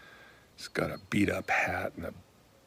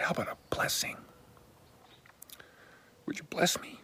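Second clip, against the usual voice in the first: gentle whispered ask; 8 semitones higher, faster than usual. Retold or imitated. Man asks old stranger for a blessing, hushed.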